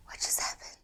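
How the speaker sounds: acted-out hushed, voiceless disbelief; stunned whisper. Actor alone in the car after a bad audition, stunned whisper. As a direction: whisper it to yourself, dazed.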